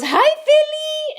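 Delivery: very high, slow, drawn-out "Hi, Philly"; a sky-high, slow "Hi, Philly" greeting to the camera. Retold or imitated. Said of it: "this [name] impersonation".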